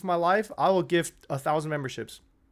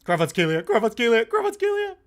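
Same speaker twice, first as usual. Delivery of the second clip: rising commentary call; 3 semitones higher than usual. Real, not acted. Streamer calling the run to chat, building excitement. Calling player running with ball.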